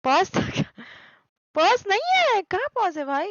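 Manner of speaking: loud, high protest to chat; indignant exclaim. Real, not acted. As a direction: insist loudly it's not paused. Insisting to chat stream isn't paused.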